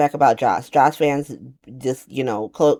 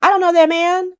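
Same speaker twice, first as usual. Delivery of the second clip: much higher, louder insistence; indignant teen denies knowing the man, high and loud; high sassy denial; 12 semitones higher, 4 dB louder than usual. Retold or imitated. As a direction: squeal it, outraged and high. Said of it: "Charlotte was so funny".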